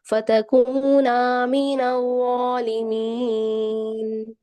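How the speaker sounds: slow, drawn-out tajweed recitation; Quran recitation. Acted. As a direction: recite the verse slowly and melodically.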